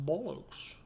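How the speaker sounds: posh-accented single word, rising. Shown as a demonstration. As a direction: attempt a posh British accent. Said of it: "sounds absolutely fantastic in a posh accent".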